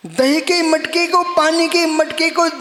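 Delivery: high, driving sermon delivery; impassioned preaching. Real, not acted. Monk warning devotees not to touch the pots, impassioned preaching. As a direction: press each example home forcefully.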